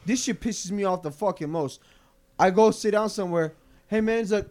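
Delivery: normal talking voice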